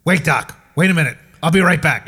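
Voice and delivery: urgent patient. Retold or imitated.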